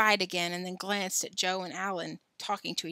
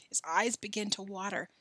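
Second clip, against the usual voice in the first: usual speaking voice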